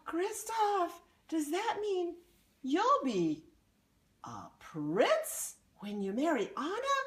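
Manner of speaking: high, eager troll voice; a troll wondering aloud; curious troll. Acted. Troll asks Kristoff.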